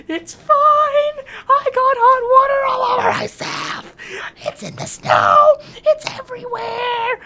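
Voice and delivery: very high, loud squealing wail; panicked screech. Real, not acted. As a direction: shriek it as if scalded. Hot water spilled all over.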